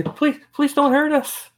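high, slow, desperate plea; frightened pleading. Acted. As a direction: beg them not to hurt her. DM voices pleading mother.

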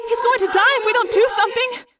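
high, loud, desperate outburst; crying out. A narrator's reading. Friend of the wounded one demands action, desperate cry. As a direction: cry out in alarm for a dying friend. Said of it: "Rachel cried".

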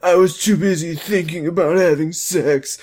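high, mock tearful Ricky; Ricky cry voice. Ricky blames himself to Amy, mock crying. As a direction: whine it like a hammed-up crying boy. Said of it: "we got a Ricky cry voice".

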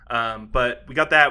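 normal talking voice

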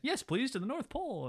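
high, polite, naive reply; lightly voiced innocent. Frosty takes cop's ticket offer literally, lightly imitated innocence. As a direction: answer eagerly in a lifted, innocent voice.

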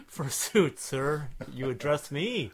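pompous olde English, slow and grand; hammy stage actor. Acted. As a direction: declaim it like a ham Shakespearean actor. Mock medieval banter.

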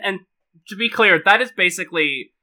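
ordinary talk, nothing marked

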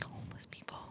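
hushed whisper so as not to disturb. Real, not acted. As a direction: whisper it like someone might hear. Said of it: "Why are you whispering?".

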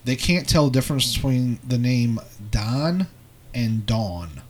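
normal talking voice

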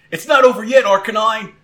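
loud, high trainer's call; rally your fighter; rallying shout. Acted. Trainer urges Arcanine on, calling out.

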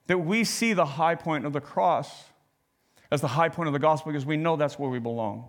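ordinary talk, nothing marked

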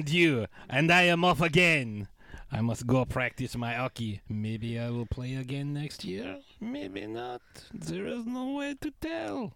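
put-on goofy French-Canadian accent, Borat-like; hammy French accent, halting English; Patrice Bergeron French accent. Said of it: "That guy was angry".

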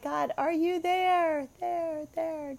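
high, drawn-out call fading like an echo. Acted. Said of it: "echoing out there and nothing comes back".

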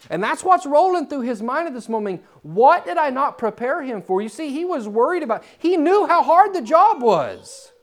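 high, loud, impassioned preaching; full-force preaching. Acted. Preacher driving home the prophet's burden to the congregation, loud and impassioned. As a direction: preach it out to the whole room.

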